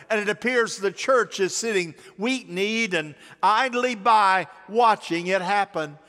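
normal talking voice

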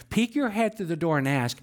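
ordinary delivery

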